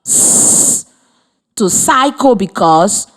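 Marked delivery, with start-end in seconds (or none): 0.0-0.9 a hissed unvoiced s on its own; s held as a breathy hiss; s sound. Shown as a demonstration. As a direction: isolate the s sound. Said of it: "We added the sound".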